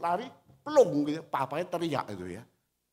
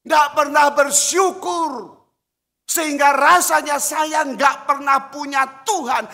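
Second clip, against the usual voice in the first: shouted rebuke; 9 semitones higher, 11 dB louder than usual. Real, not acted. Preacher rebuking worry.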